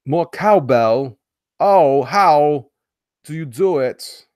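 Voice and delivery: mock Walken demanding cowbell; Walken impression. Streamer drops a Walken catchphrase mid-rant, slow and loud.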